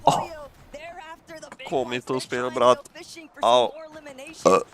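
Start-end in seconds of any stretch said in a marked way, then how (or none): 3.4-4.6 in pain. Real, not acted. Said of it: "Pizda sem si nabil nogo".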